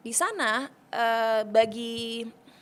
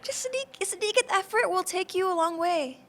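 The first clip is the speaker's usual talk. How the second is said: sedikit lifted high and light, then effort punched; playful sing-song sedikit, firm on effort; emphatic repeat; 8 semitones higher than usual. Shown as a demonstration. Guest repeating her own phrase for the host, playful emphasis. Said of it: "aku suka sekali kata-kata sedikit effort".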